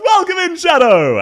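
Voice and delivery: high, loud welcome to chat; excited greeting. Real, not acted. Greeting a new chatter, loud and high. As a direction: sing out the welcome.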